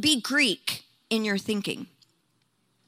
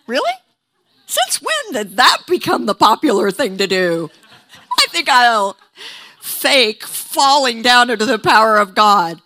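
sarcastic mockery; 3 semitones higher, 8 dB louder than usual. Mocking skeptics' accusation.

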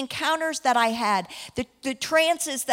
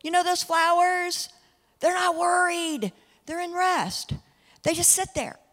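gentle Jesus voice; 6 semitones higher than usual. Retold or imitated. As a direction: reassure like a kind teacher, lifted high. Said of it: "and he's like".

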